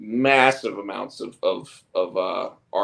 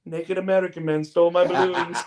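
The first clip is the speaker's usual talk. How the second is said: put-on higher, laughing little-kid voice; kid voice through laughter; 7 semitones higher, 4 dB louder than usual. Retelling the movie line, laughing in kid voice. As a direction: crack up while quoting the boy. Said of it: "And he's like".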